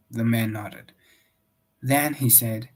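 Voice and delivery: plain reading voice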